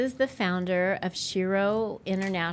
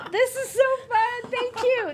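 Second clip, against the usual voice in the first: laughing with delight; 13 semitones higher, 5 dB louder than usual. Real, not acted. Gushing over gift contents.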